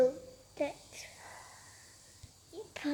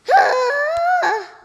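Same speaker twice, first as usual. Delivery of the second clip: loud sigh; 12 semitones higher, 11 dB louder than usual. Real, not acted.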